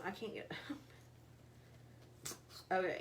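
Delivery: laughing through a jar lid in teeth; gritted teeth on a stuck lid; tearing with teeth. Real, not acted. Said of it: "but I'm gonna use my teeth".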